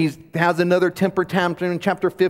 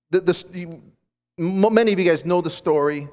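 ordinary talk, nothing marked

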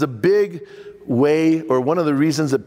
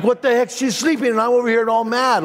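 mock outrage in bed; ticked-off rant; 7 semitones higher than usual. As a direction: fume about her sleeping, voice pitched up. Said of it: "all ticked off".

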